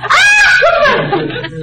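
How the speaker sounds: हँसी का ठहाका; burst of laughter. Acted. Laughing at herself in mirror.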